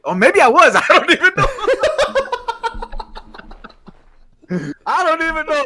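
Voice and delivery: high, loud, helpless laughing admission; cracking up. Real, not acted. Admitting to the co-host he may have messed up, cracking up. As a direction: laugh through it, voice shooting up.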